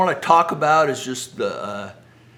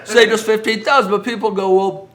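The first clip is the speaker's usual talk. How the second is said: laughing; 9 semitones higher, 9 dB louder than usual. Real, not acted. Speaker continues amid laughter.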